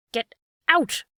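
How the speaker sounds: loud, high threatening shout. A narrator's reading. Fisherman threatening the hungry dog, loud shout. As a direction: bark it to drive the dog away. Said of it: "cried the fisherman threateningly".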